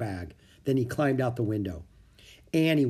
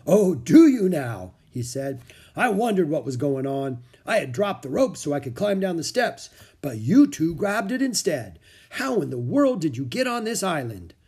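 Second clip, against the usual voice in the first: higher, animated storybook monk; jolly monk, questioning; 5 semitones higher, 5 dB louder than usual. Acted. Monk questions two children who took his rope, jolly monk voice. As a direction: play an amused monk questioning strangers.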